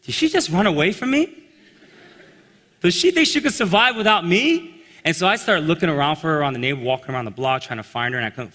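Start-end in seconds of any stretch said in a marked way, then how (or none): 0.1-4.8 higher, louder disbelief; stunned and indignant question; can she really survive without him; incredulous. Retold or imitated. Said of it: "And then I thought".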